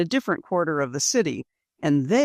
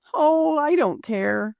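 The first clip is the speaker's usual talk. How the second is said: gallant; 9 semitones higher, slower than usual. Acted. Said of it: "with a gallant laugh".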